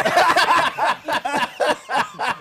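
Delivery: high burst of laughter; loud laugh. Real, not acted. Laughing at own story.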